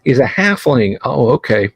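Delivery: ordinary delivery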